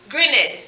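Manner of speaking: GRINNED said as two syllables, grin-ed; two-syllable mispronunciation. Shown as a demonstration. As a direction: sound out the ED in Grinned as its own syllable. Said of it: "both the E and the D making the ed".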